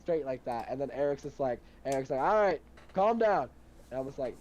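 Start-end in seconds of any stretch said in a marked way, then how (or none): 2.2-3.5 slow, louder and higher call to calm down; imitated firm order. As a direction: re-enact someone stepping in, drawn out. Eric's retold order to calm down.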